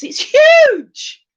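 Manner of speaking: loud, very high exclamation on huge; over-the-top high whoop; hammed-up amazement. Exclaiming over size of van.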